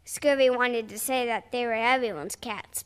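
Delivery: ordinary delivery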